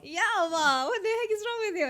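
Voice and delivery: high, loud mock outrage; exasperated outburst. Real, not acted. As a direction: scold your friend in playful disbelief.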